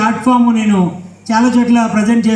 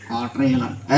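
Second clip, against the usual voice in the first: low, soft dummy voice; puppet character; 9 semitones lower, 10 dB quieter than usual. Acted. Puppet answering the ventriloquist's question, low puppet voice. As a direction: sneak it in as the puppet, low and quiet.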